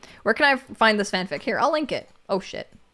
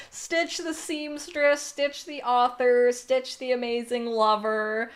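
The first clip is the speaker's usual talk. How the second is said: grand mock tribute; 4 semitones higher, 6 dB quieter, slower than usual. Grandly listing a friend's talents.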